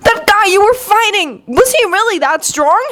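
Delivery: high-pitched, appalled questioning; alarmed, high questioning; aghast. A narrator's reading. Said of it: "sounding aghast".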